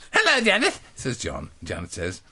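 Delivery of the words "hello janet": put-on higher and louder, chirpy little boy; hammed-up little boy greeting; eager boy. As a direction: greet her like an eager schoolboy. John voiced in the story.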